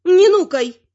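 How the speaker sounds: high, loud granny rebuke; snappish granny. A narrator's reading. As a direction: snap back as a bossy grandmother. Granny snaps at the troll woman.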